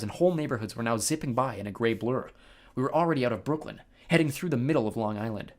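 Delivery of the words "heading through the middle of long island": usual speaking voice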